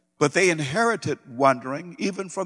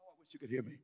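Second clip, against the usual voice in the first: quiet aside; 4 semitones lower, 15 dB quieter, much faster than usual. Real, not acted. Preacher aside, voice dropping low.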